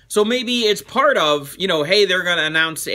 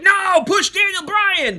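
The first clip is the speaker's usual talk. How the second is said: high, drawn-out mock wail; protesting crowd; 11 semitones higher, slower than usual. Wrestling fans objecting to Roman Reigns, protesting. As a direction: wail it as outraged fans, much higher and slower.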